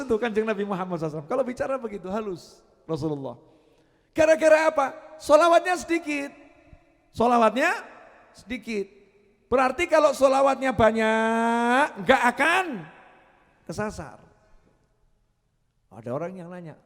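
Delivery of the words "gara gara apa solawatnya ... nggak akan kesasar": louder, higher, slow punchy preaching. Acted. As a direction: drive each phrase home. Preacher raises voice again. (ceramah dengan suara keras)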